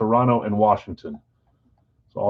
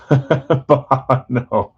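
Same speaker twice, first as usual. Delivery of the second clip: laughing; 3 semitones higher, 5 dB louder than usual. Real, not acted. Laughs while answering viewer Bob.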